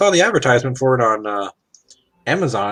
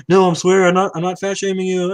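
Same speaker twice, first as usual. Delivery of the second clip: defensive protest; 8 semitones higher than usual. Retold or imitated. Acted defensive explanation.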